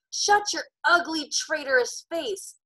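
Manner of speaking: high, slow screamed insult; screaming on. Acted. Ben screams insults at Thomas, high and slow. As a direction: spit the words at the traitor. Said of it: "Ben screamed".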